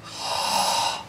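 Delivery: audible open-mouth breath in; second deep, noisy inhale; demonstrated second inhale. Shown as a demonstration. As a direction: draw a further breath up into the chest. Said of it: "We pull that breath up into the chest".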